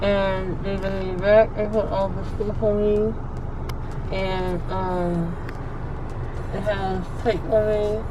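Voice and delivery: soft, woozy post-anesthesia mumbling; groggy after surgery. Real, not acted. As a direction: talk drowsily, still foggy from sedation. Just out of surgery, sedated.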